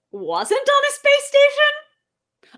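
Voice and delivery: mock outrage. Mock disbelief at Robin doubt.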